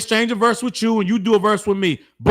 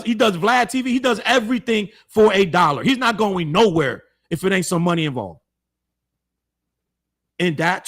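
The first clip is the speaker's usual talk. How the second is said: ordinary talk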